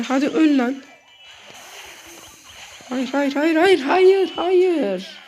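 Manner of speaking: high, loud, desperate "hayır" shouts; frantic yelling. Real, not acted. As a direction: panic as the fight is lost. Losing the fight in the game. (yüksek sesle çaresizce bağırış)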